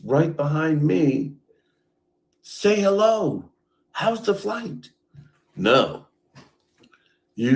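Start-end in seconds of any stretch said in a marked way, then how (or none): 2.4-4.9 higher, sing-song polite greeting; mock cheery greeting. Mimicking the greeting he expected from Ray, mock cheery. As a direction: chirp it like a forced pleasantry.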